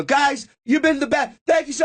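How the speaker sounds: high, hurried-out farewell call; stage sign-off. Retold or imitated. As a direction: bail out with a hollered thanks. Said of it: "I go".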